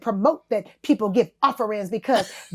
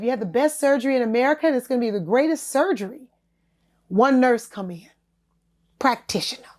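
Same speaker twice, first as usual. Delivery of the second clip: ordinary talk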